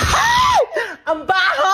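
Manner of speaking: high shrieking yelp of disgust. Acted. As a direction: shriek at the bad breath. Smelling sister's breath.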